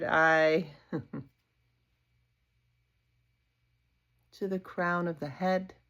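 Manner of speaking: unhurried yoga nidra voice with long pauses; guided meditation. Acted. Yoga teacher leading a body scan for sleep, guided meditation. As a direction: guide attention gently through the body, leaving space after each phrase.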